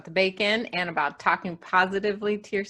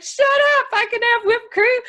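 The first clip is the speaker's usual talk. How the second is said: much higher and louder, thrilled squeal; excited disbelief; 6 dB louder than usual. Retold or imitated. Host recalls reading a whipped cream label, squealing with delight. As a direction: squeal it like you can't believe the good news. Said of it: "and I was like".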